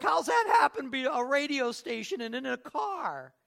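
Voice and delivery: high, loud mock yell of disbelief; amazed shouting. Friend amazed at a radio station in a car, hammed-up shouting. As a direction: imitate a friend yelling, utterly astonished.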